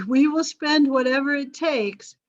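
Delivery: amused mock official vow; mock grand promise. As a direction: puff it up like an official making a big promise.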